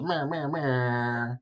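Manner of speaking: slow, drawn-out air-horn style "meh"; vocal imitation of a party sound effect; imitated sound effect. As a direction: mouth a sound effect, stretching the last one. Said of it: "that sound effect that goes".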